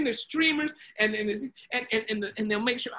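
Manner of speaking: plain reading voice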